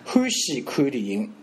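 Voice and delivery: 慢慢念"欢喜看电影"，"看"与"欢"同韵; 用上海话放慢示范"欢喜看电影"; 上海话示范. Shown as a demonstration. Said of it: "那连起来念呢". (上海话慢读"欢喜看电影")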